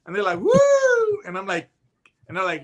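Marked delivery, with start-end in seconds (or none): 0.4-1.2 very high, loud mock siren; siren whoop. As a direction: whoop like a patrol car siren. Imitating MP siren again.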